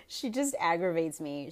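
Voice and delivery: ordinary talk, nothing marked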